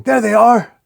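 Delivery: high, loud alarm call; screaming. A narrator's reading. Cyclops spotting the enemy, screaming. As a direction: cry out at full pitch on spotting the enemy. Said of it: "Cyclops screaming".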